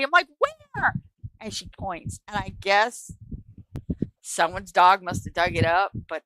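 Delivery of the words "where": very high, squealed disbelief; shocked question. Retold or imitated. Teen told by neighbor of a dead body, shocked. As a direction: let the voice leap right up in alarm.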